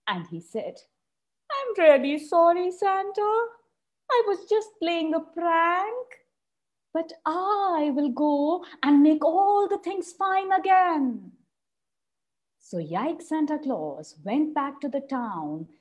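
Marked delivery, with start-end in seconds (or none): 1.5-11.3 crying. Acted. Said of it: "started crying".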